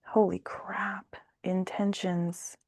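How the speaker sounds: slow, soft whisper of amazement; hushed, slow astonishment; whispered shock. Real, not acted. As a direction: breathe it out in disbelief. Reacting to a card.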